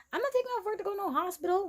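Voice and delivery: high, rapid retold refusal; rushed, high-pitched acted refusal; sassy refusal. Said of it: "I'm like".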